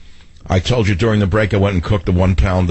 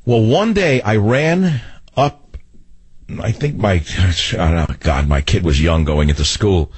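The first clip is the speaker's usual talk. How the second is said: ordinary talk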